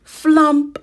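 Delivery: a high, loud thump of a landing; loud flump. A narrator's reading. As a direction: land it with a bump. Sound effect of landing.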